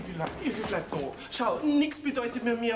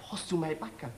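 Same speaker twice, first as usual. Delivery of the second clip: softer, secretive low voice; hushed question; 5 semitones lower, 5 dB quieter than usual. Acted. Young man asking his girlfriend for the parcel, hushed. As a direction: ask it so nobody overhears. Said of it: "Was flüsterst denn auf einmal so".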